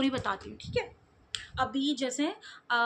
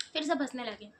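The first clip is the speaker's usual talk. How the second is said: usual speaking voice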